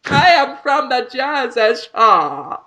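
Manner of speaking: badly exaggerated reality-show character; Jersey Shore impression. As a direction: drag out every word in a silly high voice. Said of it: "That is my impression of the Jersey Shore".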